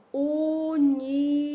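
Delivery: "universo" drawn out as u-ni-ver-so; slow, held syllables on "universo". Shown as a demonstration. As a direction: stretch each of the four syllables of "universo". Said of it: "abro a minha boca quatro vezes".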